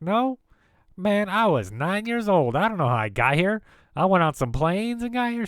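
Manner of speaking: high, loud incredulous reply; retold baffled comeback, pitched high. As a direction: protest your innocence, voice shooting way up.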